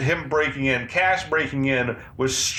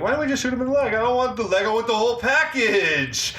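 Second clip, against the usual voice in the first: mock high quote; 9 semitones higher than usual. Quoting Stallone line mockingly.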